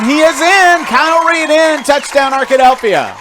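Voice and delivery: shouted high touchdown call. Real, not acted. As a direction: belt out the score, way up high.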